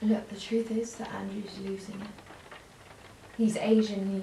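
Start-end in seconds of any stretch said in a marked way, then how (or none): 0.0-2.1 breathy confiding whisper. Acted. Confiding to her sister about Andrew, whispered. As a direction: lean in and whisper the secret.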